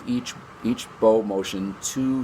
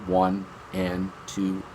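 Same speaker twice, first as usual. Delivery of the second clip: counting syllables; 2 semitones lower, slower than usual. Shown as a demonstration. Teacher showing one beat per two syllables, slowly. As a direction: tick off each syllable slowly. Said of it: "for each syllable that you say".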